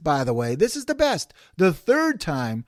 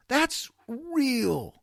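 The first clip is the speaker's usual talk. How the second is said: higher and softer echo; higher soft repeat; 8 semitones higher, 4 dB quieter than usual. Shown as a demonstration. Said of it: "Now, that's real".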